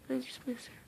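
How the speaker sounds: a soft, breaking whisper of grief; choked whisper. Real, not acted. Vlogger telling viewers she misses her lost dog, choked whisper. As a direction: let the words almost vanish, close to tears.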